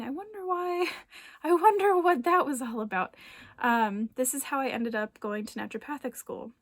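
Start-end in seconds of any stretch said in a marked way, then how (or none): 0.0-3.1 high, louder musing with a laugh; playfully curious. Pretend. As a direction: wonder aloud, amused at yourself. Acting out curious self-reflection.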